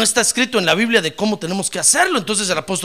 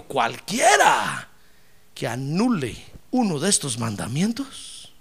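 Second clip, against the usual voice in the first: solemn quote; 5 semitones lower, 3 dB quieter, slower than usual. Acted. Quoting Jesus slowly.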